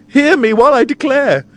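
very high, loud proclamation; heroine's ringing outcry; melodramatic cry. A narrator's reading. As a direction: demand a hearing at full theatrical pitch. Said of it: "she cries".

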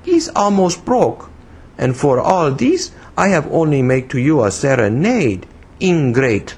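put-on reproachful broken English, girlishly high; broken English, infantine. A narrator's reading. Woman blaming him for her guitar, reproachful foreign accent. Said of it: "broken English that seemed as much infantine as foreign".

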